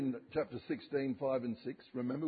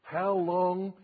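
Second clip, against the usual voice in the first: pleading cry; 5 semitones higher, 6 dB louder than usual. Retold or imitated. Crying out the saints' plea.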